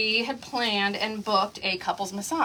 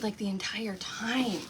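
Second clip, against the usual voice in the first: whisper; 4 semitones lower, 11 dB quieter than usual. Retold or imitated. Quietly telling husband he snored.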